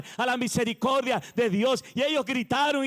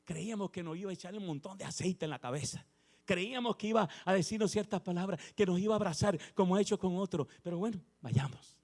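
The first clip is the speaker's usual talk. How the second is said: low, much softer murmur of mild disappointment; lepers muttering to each other; 5 semitones lower, 8 dB quieter than usual. Retold or imitated. Lepers nudging each other, disappointed, hushed grumbling. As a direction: nudge each other and grumble under your breath. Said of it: "se miraban y decían".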